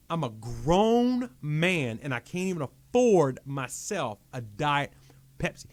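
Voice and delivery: loud, slow, drawn-out complaint to God; retold angry yelling. Man yelling at God over a Diet Pepsi, retold anger. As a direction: re-enact an indignant rant, leaning hard on key words. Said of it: "I'm screaming, and I'm like".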